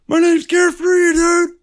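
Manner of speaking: very high, loud mock squeal of a friend; mocking squeaky voice. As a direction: squeak it out to tease him. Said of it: "You were like".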